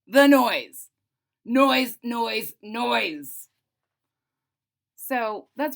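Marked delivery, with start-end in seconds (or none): 0.1-3.5 loud outburst. A narrator's reading. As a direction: burst out loud on the noise. Narrator repeats the noise loudly.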